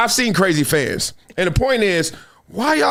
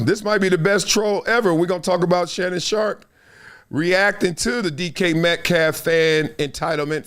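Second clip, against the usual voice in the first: usual speaking voice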